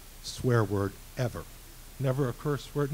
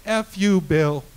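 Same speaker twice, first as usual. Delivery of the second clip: higher, louder, emphatic retort; retold angry brush-off; 5 semitones higher, 7 dB louder, slower than usual. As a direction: punch out an imitated phone rebuff.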